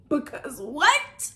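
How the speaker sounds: slow, loud, rising high on "what"; incredulous. Real, not acted. Reader answers Bitter's odd line, loud rising disbelief. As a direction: stretch it up into a baffled question.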